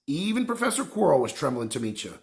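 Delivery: plain reading voice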